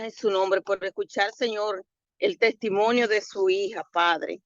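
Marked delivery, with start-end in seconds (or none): none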